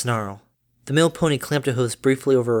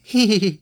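light laugh; 8 semitones higher, 5 dB louder than usual. Acted. Mother laughs after joke.

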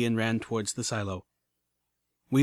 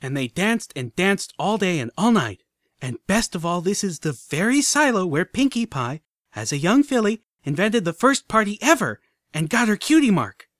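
excited tour guide; 7 semitones higher, 5 dB louder than usual. A narrator's reading. Filly giving the museum tour.

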